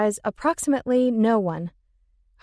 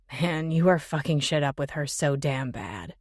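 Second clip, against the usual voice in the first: male voice; 7 semitones lower, 3 dB quieter than usual. Acted. Jimmy scolding Ben.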